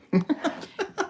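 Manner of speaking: high chuckle; light laugh. Real, not acted. After own joke.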